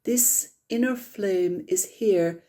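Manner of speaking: calm, unhurried meditation guide; guided meditation. Acted. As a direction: ease the group gently into stillness.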